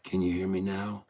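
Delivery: hushed golf announcer voice; softly, like a golf commentator. Shown as a demonstration. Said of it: "even when he talks in his golf voice".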